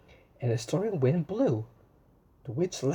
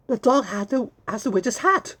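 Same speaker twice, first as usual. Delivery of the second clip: surprised discovery; 7 semitones higher, 4 dB louder than usual. Acted. Gasp at dog with hat.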